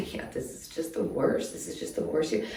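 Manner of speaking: whispered, worn out and defeated. Real, not acted. Vlogger whispering to her viewers about the worst year. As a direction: breathe the words out with no voice left. Whispered despair after venting.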